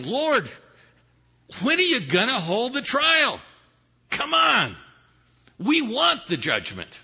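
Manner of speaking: high, impatient cry to the Lord; acted saints' plea, pitched high and insistent; calling out. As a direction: call up to heaven, demanding action. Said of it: "you now have the saints calling".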